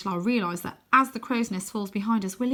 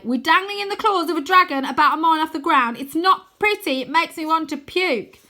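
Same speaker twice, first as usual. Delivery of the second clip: higher, louder, queasy panic; scared complaint; 9 semitones higher, 8 dB louder than usual. Acted. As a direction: plead while sick with fear. Arthur terrified on dragon.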